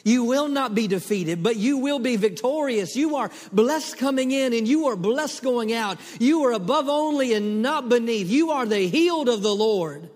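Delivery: loud, high proclaiming blessing; fiery declaration. Acted. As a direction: proclaim it over them loudly.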